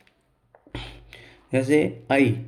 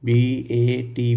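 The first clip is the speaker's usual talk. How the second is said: letters spelled one by one, very slowly; spelling the word for students; 2 semitones lower, much slower than usual. Shown as a demonstration. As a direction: dictate each letter slowly. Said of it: "मैंने बोला बैट".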